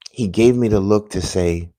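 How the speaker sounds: ordinary talk, nothing marked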